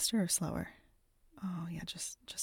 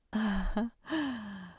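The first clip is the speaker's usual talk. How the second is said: a louder bright laugh; pleased laughter; delighted laugh; 3 semitones higher, 4 dB louder than usual. Real, not acted. Laughing after climax.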